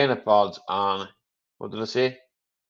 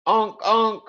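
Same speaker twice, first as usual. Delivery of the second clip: mock horn honk; 9 semitones higher than usual. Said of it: "you going".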